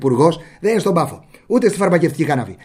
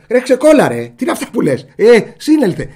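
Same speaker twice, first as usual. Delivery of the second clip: higher, louder jab at a pal; mock exasperated scolding; 6 semitones higher, 5 dB louder than usual. Telling off a friend in casual talk, mock exasperated. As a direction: snap at a friend in mock disbelief.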